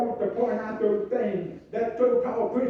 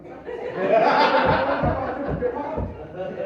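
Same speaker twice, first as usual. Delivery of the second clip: laughing. Real, not acted. Laughing at own joke.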